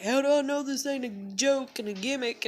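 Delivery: high-pitched imitation of his freak-out; mock flipping out. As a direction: mockingly quote him flipping out. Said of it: "he flipped the fuck out on me".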